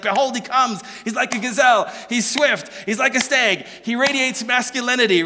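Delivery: each phrase fired out with excitement; excited staccato. Retold or imitated. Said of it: "staccato presentations of statements kinda lets you know that she's excited".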